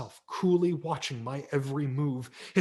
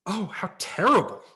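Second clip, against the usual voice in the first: higher, louder shocked mom; appalled mother; 7 semitones higher, 8 dB louder than usual. Acted. Mother seeing the Frankenstein mask, appalled. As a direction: gasp it out in disgust. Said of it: "my mother said".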